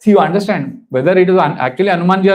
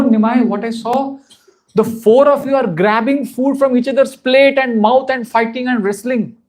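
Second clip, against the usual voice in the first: mother recounting dream; 6 semitones higher, slower than usual. Retold or imitated.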